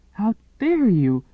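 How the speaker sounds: high, loud indignant cry; furious outburst. Acted. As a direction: fling it at him in outrage. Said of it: "Whitney hissed".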